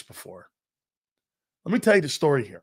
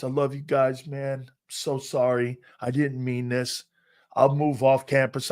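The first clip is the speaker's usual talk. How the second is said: ordinary delivery, nothing marked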